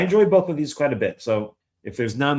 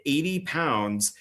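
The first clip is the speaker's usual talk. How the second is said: normal talking voice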